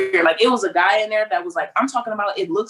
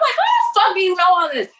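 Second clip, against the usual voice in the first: much higher, incredulous; pitched way up in disbelief; shocked disbelief; 12 semitones higher than usual. Retold or imitated. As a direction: act floored that he knows. Shocked at the officer's knowledge.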